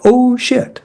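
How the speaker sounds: high, startled curse under the breath; alarmed oh shit. Retold or imitated. Retelling his alarm when the client screams, a high startled curse.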